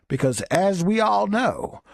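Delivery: normal talking voice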